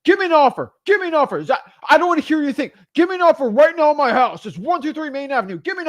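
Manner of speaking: loud, high, impatient demands; pushy homeowner hammering the caller; aggressive seller. Retold or imitated. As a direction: hammer him for an offer. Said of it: "a lot of very aggressive people".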